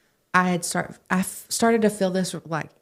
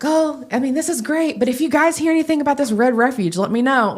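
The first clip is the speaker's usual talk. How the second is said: higher, eager and a bit pushy; excited plea; 8 semitones higher, 5 dB louder than usual. Retold or imitated. Asking her youth leaders for news, excitedly eager. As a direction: gush it out, can barely contain it. Begging for news of Red Refuge.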